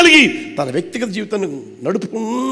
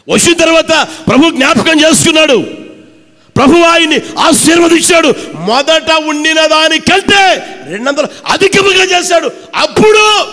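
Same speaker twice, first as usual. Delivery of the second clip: louder and higher, rising to a peak; fiery preaching; 6 semitones higher, 3 dB louder than usual. Acted. Preacher proclaiming Job's double blessing, fiery shout.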